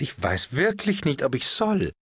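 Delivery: hesitant duchess. Acted. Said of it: "sagte die Herzogin".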